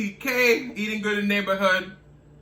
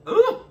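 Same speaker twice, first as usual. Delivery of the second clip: high ooh from the heat; 13 semitones higher, 5 dB louder than usual. Real, not acted. Reacting to spicy heat.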